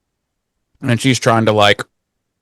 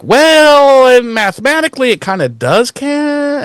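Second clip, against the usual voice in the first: pedantic Buffy; 13 semitones higher than usual. Retold or imitated. Buffy correcting Spike's count, very high prim voice. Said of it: "And she's like".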